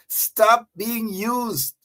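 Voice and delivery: every word slow and separate, stop leaning hard; slow emphatic command. Shown as a demonstration. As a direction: preach it, one word at a time. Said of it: "Turn to your neighbor and say".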